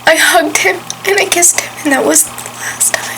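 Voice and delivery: fighting back tears as the voice cracks; real grief, voice breaking into a whisper. Real, not acted. Recalling last hug with dying dad.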